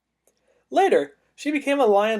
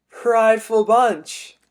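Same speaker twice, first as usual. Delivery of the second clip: punchline delivery; 5 semitones higher, 5 dB louder, much slower than usual. Pretend. Punchline of lion pun.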